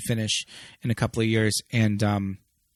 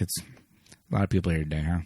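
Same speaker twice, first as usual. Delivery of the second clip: low, quiet imitation of a shady remark; offhand, understated shade, low and soft; 8 semitones lower, 8 dB quieter than usual. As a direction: retell it shadily, dropped low and soft. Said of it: "she delivers it super shadily".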